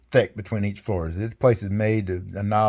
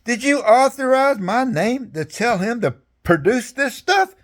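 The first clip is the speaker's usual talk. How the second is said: much higher, slow mock questioning; the general questioning him before the colonel, high and slow; imitating the general; 14 semitones higher, 4 dB louder, slower than usual. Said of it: "He goes".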